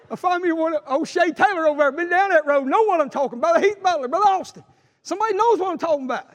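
high, loud preacher call to his friends; preaching at full force. Acted. Preacher calling out to church brothers, high and loud.

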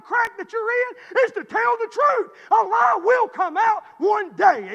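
loud, driving preacher's climb; preaching at full force. Acted. Preacher urging the congregation to tell the truth, loud fervent. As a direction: shout the lesson to the congregation.

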